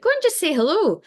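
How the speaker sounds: high, put-on voice of a merry friend; tipsy friend. Tipsy friend urging her to say hello, high and put-on. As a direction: squeak it out like a tipsy mate egging her on.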